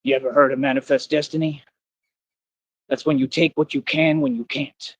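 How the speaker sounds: a hint of a movie mobster's voice; movie gangster voice. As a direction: mimic the gangster from the film, menacing but only lightly voiced. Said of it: "Let me try to do it in his voice".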